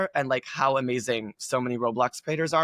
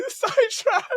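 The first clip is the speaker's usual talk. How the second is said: laughing; 4 dB louder, slower than usual. Real, not acted. Laughs off her own tangent.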